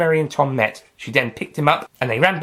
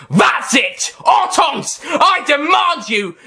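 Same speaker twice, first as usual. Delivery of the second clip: high, loud, slow furious shout; furious demand; 5 dB louder, slower than usual. Acted. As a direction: command them in a rage. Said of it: "The doctor then snapped".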